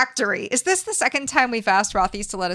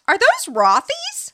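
excited question; 5 dB louder than usual. Pretend. Mock-asked question in ad.

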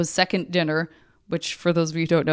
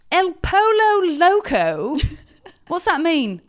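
imitating Chris's British accent; 8 semitones higher, 3 dB louder than usual. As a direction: sound out each foreign word like it's alien to the tongue. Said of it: "making everything sound like it's alien to his tongue".

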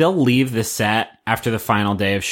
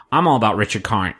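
ordinary talk

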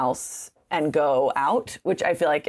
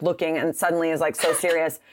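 ordinary talk

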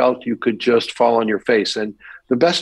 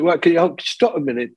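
put-on higher, Cockney-tinged director's British accent; 8 semitones higher than usual. British director cutting into an actor's audition, higher British accent. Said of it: "it's a British accent".

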